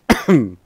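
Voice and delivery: sudden loud cough after a sip; real cough. Real, not acted. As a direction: cough as water goes down wrong. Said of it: "j'ai toussé quand même". (toux soudaine)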